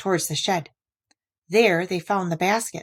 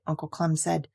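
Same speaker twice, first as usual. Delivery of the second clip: usual speaking voice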